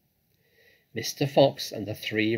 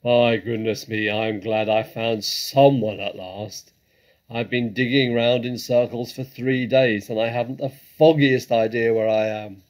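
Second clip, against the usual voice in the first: loud, blustering badger voice; big, booming character voice; exclaiming badger; 11 dB louder than usual. A narrator's reading. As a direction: blurt it out loudly in great relief. Badger exclaims to his found friend.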